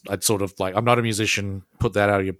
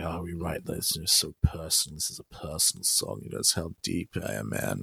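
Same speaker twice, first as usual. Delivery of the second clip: pretentious band guy; 2 semitones lower, 6 dB quieter than usual. Retold or imitated. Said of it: "some bands like".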